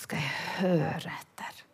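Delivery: whispered to herself. Retold or imitated. Telling herself to listen, in a whisper. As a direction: breathe it to yourself, no voice.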